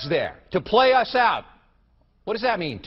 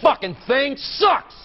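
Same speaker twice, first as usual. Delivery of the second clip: slow, louder furious curse; angry outburst; 7 semitones higher, 5 dB louder, slower than usual. Real, not acted. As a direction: spit it out slowly in rage. Host cursing the teleprompter.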